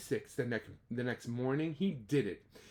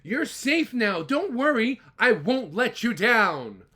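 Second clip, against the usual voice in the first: called back; 7 semitones higher, 9 dB louder than usual. Acted. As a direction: call down firmly to the mayor. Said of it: "Horton called back".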